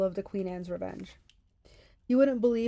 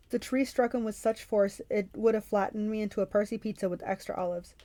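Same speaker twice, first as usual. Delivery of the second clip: ordinary delivery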